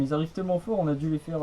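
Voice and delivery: ordinary talk, nothing marked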